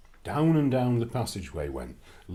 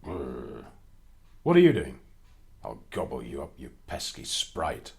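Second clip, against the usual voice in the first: growling ogre; 8 semitones lower than usual. Acted. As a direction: rumble it like a grumpy ogre. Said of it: "a shout that sounded very like a growl".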